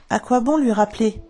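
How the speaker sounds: usual speaking voice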